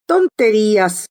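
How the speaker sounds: ordinary delivery, nothing marked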